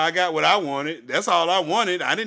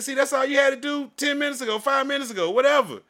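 scolding; 9 semitones higher than usual. Retold or imitated. Scolding cashier.

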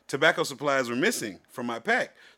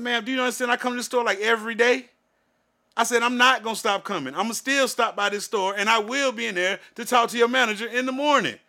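defiant retort; 6 semitones higher than usual. Retold or imitated. Arguing back at cashier.

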